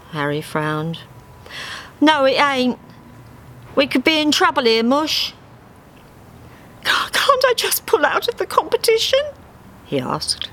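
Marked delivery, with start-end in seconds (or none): 2.0-5.3 high, quick worried cockney duck; Harry's cockney voice. Acted. Harry worrying about Dave's chances, quick and high. 6.8-9.2 very high timid duck voice; Dave's voice. Acted. Dave begging to quit the contest, high and timid.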